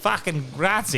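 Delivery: put-on high, emphatic Aussie-accented thanks; mocking Aussie-accented Italian; mocking tourist Italian. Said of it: "she'd be like".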